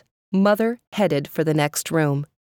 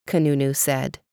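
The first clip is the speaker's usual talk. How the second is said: usual speaking voice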